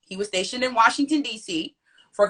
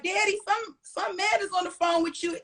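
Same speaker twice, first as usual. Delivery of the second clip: put-on little girl calling out; 8 semitones higher than usual. Little girl calling her daddy.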